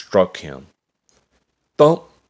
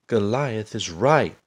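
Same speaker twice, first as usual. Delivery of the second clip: raised bright call; mild cry out; 5 semitones higher than usual. A narrator's reading. David answering Goliath, mildly raised. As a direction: call it out boldly. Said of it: "he cried".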